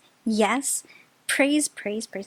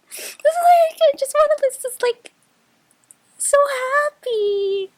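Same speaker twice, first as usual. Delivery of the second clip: tears of joy; 13 semitones higher, 3 dB louder than usual. Real, not acted. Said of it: "I don't know if to cry, like, tears of joy".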